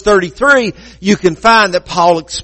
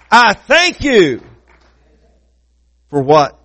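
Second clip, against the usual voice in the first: higher-pitched, rolling praise, slowing on the thanks; fervent preaching; 7 semitones higher than usual. Real, not acted.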